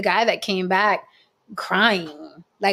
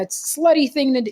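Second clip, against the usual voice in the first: mocking ex's voice; 6 semitones higher, faster than usual. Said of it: "he was just like".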